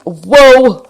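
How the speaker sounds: a high, loud drawn-out Joey-style whoa. Retold or imitated. Telling the phone store story, imitating Joey's whoa. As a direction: do your best Joey whoa. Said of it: "in my, uh, Joey voice".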